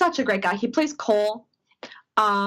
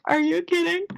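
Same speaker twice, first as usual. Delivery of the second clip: retold disbelief; 7 semitones higher than usual. Retold delighted disbelief at news.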